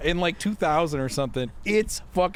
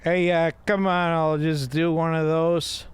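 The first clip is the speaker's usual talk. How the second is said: higher, tipsy Chicagoan; drunk Chicago accent; 4 semitones higher than usual. Acted. Drinker agreeing to one of those, drunk Chicago accent. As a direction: play a drinker with Chicago vowels. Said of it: "Like a drunk Chicagoan".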